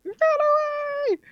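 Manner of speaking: high, excited cry; excited yelp. Real, not acted. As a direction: yelp it out in alarm. Hiding from a seeker in game.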